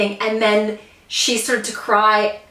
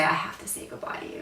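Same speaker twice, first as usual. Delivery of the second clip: whispered, much softer; quoting Joel's goodbye to Zeke in a whisper; 12 dB quieter than usual. Retold or imitated. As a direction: breathe the line out like it hurts.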